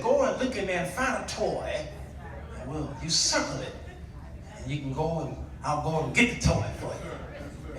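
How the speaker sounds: ordinary delivery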